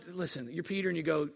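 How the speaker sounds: ordinary talk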